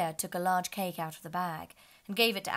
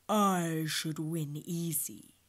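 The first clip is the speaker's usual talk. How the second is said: slow, louder boasting lion; 4 dB louder, much slower than usual. A narrator's reading. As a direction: boast lazily.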